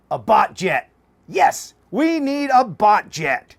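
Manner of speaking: loud, slow triumphant announcement; excited. Real, not acted. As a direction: proclaim it slowly and proudly. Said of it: "announces the hybrid".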